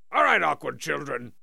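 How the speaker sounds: ordinary talk, nothing marked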